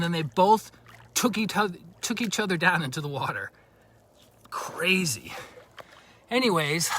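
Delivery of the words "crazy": whispered under the breath in wonder; amazed whisper. Real, not acted. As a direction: whisper it, marvelling at what you saw. Marvels at wrestling geckos.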